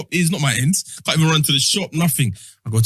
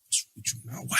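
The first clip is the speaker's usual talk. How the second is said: hushed mum; 6 semitones lower, 14 dB quieter than usual. Retold or imitated. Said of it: "Mum's looking at me like".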